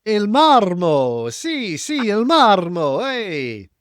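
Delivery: slow, drawn-out Italian; Italian phrases. Acted. Customs guard naming the marble, slow Italian. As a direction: savour each Italian word slowly. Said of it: "Guy goes".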